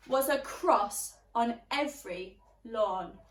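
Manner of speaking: usual speaking voice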